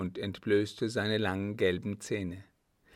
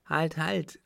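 ordinary delivery

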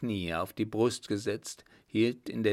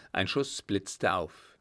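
ordinary delivery, nothing marked

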